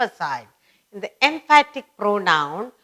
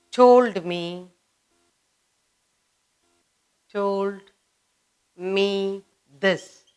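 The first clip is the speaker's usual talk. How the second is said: slow classroom dictation in separated chunks; much slower than usual. Shown as a demonstration. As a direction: dictate phrase by phrase, leaving time to write. Teacher slowly dictates exercise answers.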